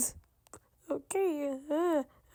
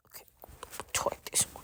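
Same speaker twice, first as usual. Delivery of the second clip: soft whispered coaxing; whispering; 13 dB quieter, much faster than usual. Real, not acted. Whispered offer to child.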